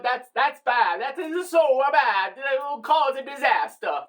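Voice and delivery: badly faked Italian accent, lilting up; mock Italian churchmen debating in a poor accent. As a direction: do a hammed-up amateur Italian accent. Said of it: "a very bad Italian accent".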